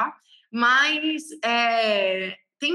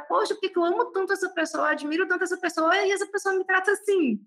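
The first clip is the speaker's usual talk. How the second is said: higher-pitched plaintive wondering; retold hurt; 7 semitones higher than usual. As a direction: replay your old hurt, lightly plaintive. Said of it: "eu ficava muito triste". (lamento magoado, em tom mais agudo)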